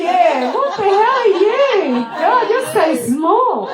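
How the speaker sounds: high, loud, slow squeaky voice through laughter; mock the tiny diver as the baby whale; hammed-up high baby voice, laughing.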